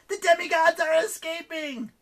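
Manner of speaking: very high, loud alarm; yelling. A narrator's reading. Eris warning that demigods are escaping, yelling. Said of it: "Eris yelled".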